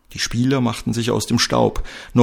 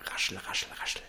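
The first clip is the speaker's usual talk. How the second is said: very soft unvoiced whisper; hushed paper-rustle sound effect; whispered rustling; 16 dB quieter, much faster than usual. Imitated newspaper rustling.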